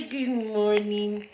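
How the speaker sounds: drawn-out, gentle good morning. Real, not acted. Vlogger greeting her viewer Sky again, slow and tender. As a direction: say it slowly and tenderly.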